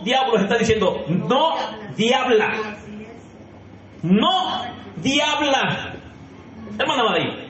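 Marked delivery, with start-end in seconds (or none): 1.1-2.5 "no diabla" stretched slowly; slow, drawn out. Shown as a demonstration. Preacher slowly warning the women not to be devils. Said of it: "te lo voy a leer en cámara lenta". 4.0-5.9 "no diabla" dragged out very slowly with a laugh; slower, laughing. Shown as a demonstration. Preacher repeating the warning to the women, very slow and amused. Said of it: "te lo voy a leer en cámara lenta".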